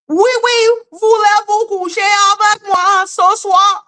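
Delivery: high, gushing bad-French parody; mocking foreigners' French. Mocking those who make fun of her, high parody French. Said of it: "if they think they can make fun of me".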